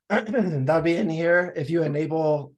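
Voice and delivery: throat still rough after choking on water; a hoarse, scratchy throat voice. Real, not acted. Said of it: "Sorry about this voice".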